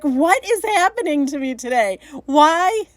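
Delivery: hammed-up what-is-happening cry; mock exasperation. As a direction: wail it in playful frustration. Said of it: "It's like".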